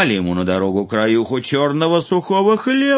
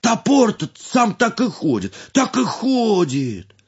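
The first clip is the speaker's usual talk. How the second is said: amazed; 10 semitones higher than usual. A narrator's reading. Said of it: "диву даётся".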